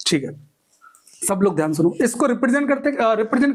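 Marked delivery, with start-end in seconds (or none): none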